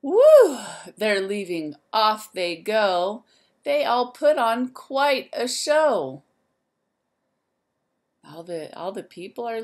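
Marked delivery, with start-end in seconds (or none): 0.0-0.6 much higher, louder joyful whoop; excited whoop. Acted. Teacher cheering as the zoo animals leave, a loud whoop. 1.0-6.2 lively, sing-song storybook voice; reading to children. A narrator's reading. Teacher reading a rhyme book to the class, lively and sing-song.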